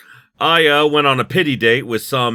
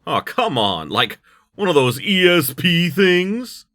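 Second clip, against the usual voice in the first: Nix's put-on accent; 7 semitones higher than usual. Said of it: "that is his accent now".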